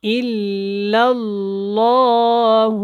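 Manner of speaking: slow, drawn-out recitation with clear letters; careful tajweed recitation. Shown as a demonstration. Teacher demonstrating the kalima to learners, slow tajweed recitation. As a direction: recite slowly, stretching each word. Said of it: "بہت دھیان سے اس کو سیکھیں".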